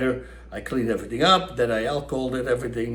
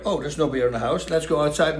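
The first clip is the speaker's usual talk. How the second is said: ordinary talk, nothing marked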